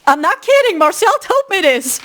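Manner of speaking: usual speaking voice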